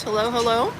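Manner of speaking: normal talking voice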